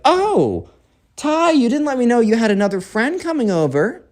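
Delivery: high, lilting mom voice; sweet surprised mom. Acted. Mom walks in on son's unexpected guest, sweetly surprised. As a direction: chirp it high, ending on a rise.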